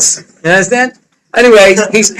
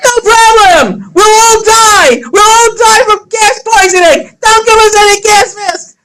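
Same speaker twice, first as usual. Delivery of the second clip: mock outraged shout; 14 semitones higher than usual. To the clerk refusing service, a high mock shout. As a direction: yell it high as if furious. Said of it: "So I said".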